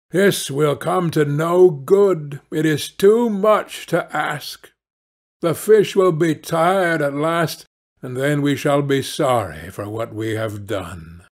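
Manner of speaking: ordinary delivery, nothing marked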